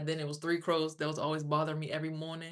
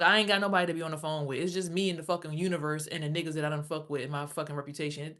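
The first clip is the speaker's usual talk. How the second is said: ordinary talk, nothing marked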